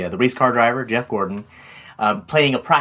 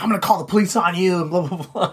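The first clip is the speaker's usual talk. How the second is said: quick, higher imitation of an angry man; mock angry threat; 5 semitones higher, faster than usual. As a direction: mimic a mad customer's threat, lightly. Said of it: "and he's so mad".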